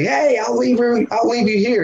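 high, alarmed stranger's voice, mildly played; mock-yell it like a startled jet skier; a hint of a panicking stranger shouting; freaked-out guy. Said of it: "And the guy's freaking".

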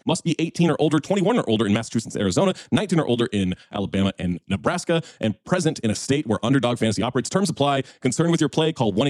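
rushed disclaimer read; fast legal disclaimer. Acted. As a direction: rattle off the fine print quickly.

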